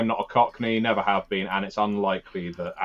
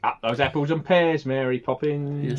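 playful fake Cockney, lightly done; mock Cockney accent; 6 semitones higher than usual. Teasing friends in a jokey Cockney accent. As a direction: toss off a jokey Cockney line.

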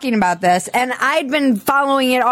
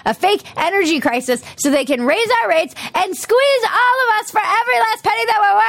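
exasperated tirade climbing much higher at the end; worked-up rant; 6 semitones higher than usual. Real, not acted. Host railing about the fake energy crisis, worked-up rant. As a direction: rail at them, pitch rising in indignation.

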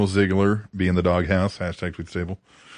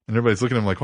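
ordinary delivery

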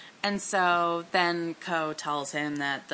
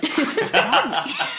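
louder, disbelieving through a laugh; David laughing; 11 semitones higher, 6 dB louder, slower than usual. Retold or imitated. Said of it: "David was just like".

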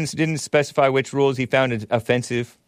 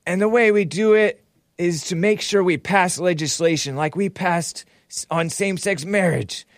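higher put-on voice of an old politician; mock the president, voice raised and fussy; Biden impression; 6 semitones higher than usual. Host doing his impression of Biden for listeners. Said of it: "This is my impression of him".

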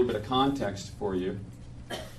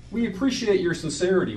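high, condescending council voice; patronizing official; 9 semitones higher, 5 dB louder than usual. Acted. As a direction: talk down like a polite official. Council member quoted in poem.

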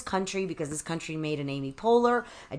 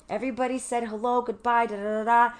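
usual speaking voice

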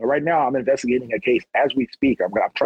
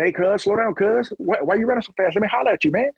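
drug dealer calling out; 8 semitones higher than usual. Retold or imitated. Dealer calls out on the street.

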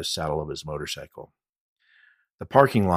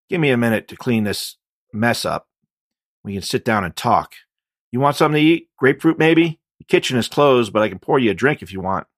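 jittery host; 6 semitones higher, 8 dB louder than usual. Acted. Man offering food and drink.

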